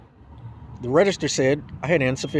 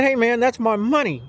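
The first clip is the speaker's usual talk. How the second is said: indignant; 7 semitones higher than usual. Retold or imitated. Demanding his money back.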